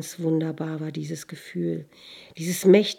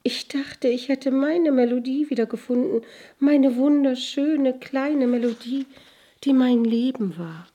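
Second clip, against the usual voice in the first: put-on high, upset girl's voice, growing louder; tearful lament; 7 semitones higher, 4 dB louder than usual. A narrator's reading. Girl tells the sun of her lost melody, tearful. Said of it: "sagte es und begann zu weinen".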